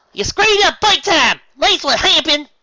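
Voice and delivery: very high, loud, slow shout; accusing yell. Acted. Apple Bloom blaming Applejack, loud high yell. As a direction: yell the blame at her.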